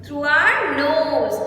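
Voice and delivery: slow, drawn-out "Through our nose"; stretched "Through our nose" with a falling end; slow modelled answer. Shown as a demonstration. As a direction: say it slowly for the kids to copy. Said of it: "teacher models answer".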